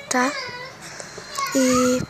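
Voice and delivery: hiccupping between words; holding back hiccups, catching breath; suppressing hiccups. Real, not acted. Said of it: "eu tô com soluço".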